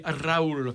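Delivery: Raoul with a trilled Spanish r; rolled r. Shown as a demonstration. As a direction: roll the R in Raoul. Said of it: "j'aime bien rouler les R".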